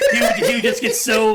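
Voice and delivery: loud laughing telling; amused laughing. Real, not acted. Laughing while telling story.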